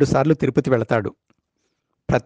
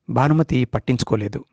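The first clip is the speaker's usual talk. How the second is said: ordinary delivery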